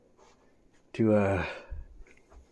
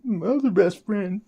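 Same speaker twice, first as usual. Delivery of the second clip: high, squeaky voice breaking with laughter; real helpless laughter; 10 semitones higher than usual. Real, not acted. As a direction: laugh through the words, barely getting them out. Breaking up over the coincidence.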